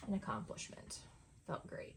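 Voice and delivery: soft unvoiced whisper of mock pride; whispered aside. Real, not acted. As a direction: breathe it out as a wry little brag. Dry self-congratulation on finishing scanning.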